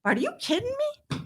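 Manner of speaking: high, quick incredulous jab; outraged disbelief. Real, not acted. Telling the hosts about being set up to be trafficked, outraged and high. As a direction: fire it back in disbelief.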